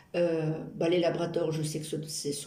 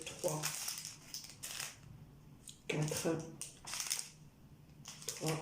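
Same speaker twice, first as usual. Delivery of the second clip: soft whispered counting right in the ear; ASMR whisper; 10 dB quieter, much slower than usual. Acted. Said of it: "je vous préviens, c'est de A S M R".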